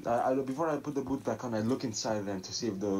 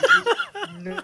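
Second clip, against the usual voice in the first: laughing plea; 10 dB louder than usual. Real, not acted. Player begging for a good roll.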